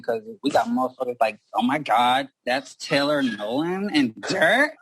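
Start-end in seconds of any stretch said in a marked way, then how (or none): 1.5-4.7 drawn-out mock fangirl exclamation, climbing at the end.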